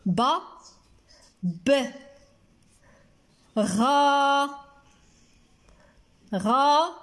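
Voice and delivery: ببغاء in isolated syllables with long pauses; slow, separated teaching syllables. Shown as a demonstration. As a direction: sound out each piece, holding غا. Writes word syllable by syllable.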